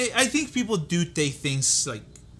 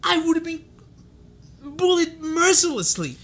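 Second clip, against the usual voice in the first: outraged; 11 semitones higher, 3 dB louder than usual. Real, not acted. Outraged rant.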